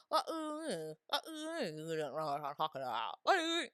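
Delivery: mock country British accent. Mimicking the show's accent, exaggerated open-mouthed British. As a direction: garble British vowels with the mouth never closing. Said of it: "they have their mouth forever open while talking".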